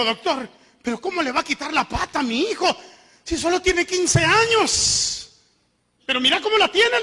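high, rising plea of a desperate mother, slowing on the age; pleading mother. Retold or imitated. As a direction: beg the doctor not to cut off your son's leg.

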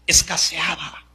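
breathy whisper for emphasis. Acted. Preacher telling how God's word grew scarce, whispering.

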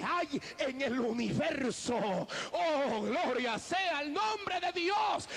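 rising, shouted preacher's cries of praise; full-force preaching. Acted. Preacher rousing the congregation, shouting praise. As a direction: preach it out to the whole church.

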